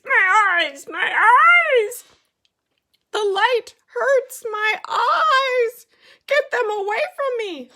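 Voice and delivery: high, loud cry of pain; pained shouting. Acted. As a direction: howl it like the light burns. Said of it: "yelps Odysseus".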